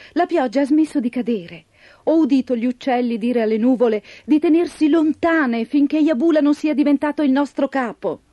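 ordinary delivery

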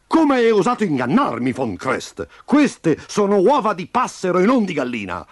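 high, outraged bellow; ranting. A narrator's reading. Farm boss to cheating rooster chief, ranting. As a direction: rant and throw him out. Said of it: "ne usciva sbraitando".

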